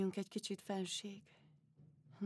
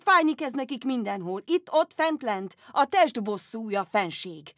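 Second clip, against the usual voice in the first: loud, blunt doctor lecturing; shouting doctor; 3 semitones higher, 9 dB louder than usual. Retold or imitated. Rude Dutch doctor scolding the empress, loud and blunt. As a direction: bark the diagnosis at her. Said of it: "kiabált, mint apa".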